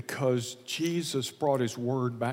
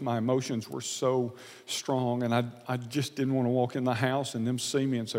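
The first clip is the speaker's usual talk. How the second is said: ordinary delivery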